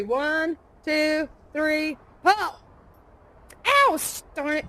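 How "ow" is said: sharp high yelp of pain. Acted. Hatch smashes her finger, sharp yelp of pain.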